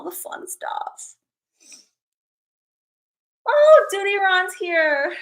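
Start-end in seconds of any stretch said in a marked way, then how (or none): 3.5-5.1 excited greeting. Real, not acted. Greeting a viewer arriving.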